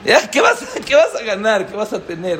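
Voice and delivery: high, loud, laughing scoff; mock incredulous. Rabbi voicing the wife's scoffing question to her husband, incredulous. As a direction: scoff up high at what he'd gain. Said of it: "no es algo pashut decir".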